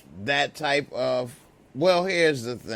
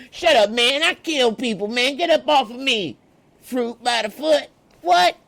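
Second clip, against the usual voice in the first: high, loud, rapid comic outburst; mock furious shout; 10 semitones higher, 7 dB louder than usual. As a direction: yell them off in a hammed-up high voice. Acts D-Mac rejecting the serenade.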